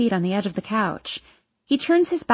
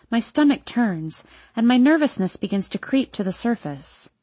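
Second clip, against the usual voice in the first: ordinary delivery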